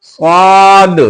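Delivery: "स्वाद" with rounded lips and long heavy aa; thick rounded स्वाद. Shown as a demonstration. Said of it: "मुंह को गोल करेंगे और मोटा करेंगे". (मुंह गोल करके मोटा "स्वाद")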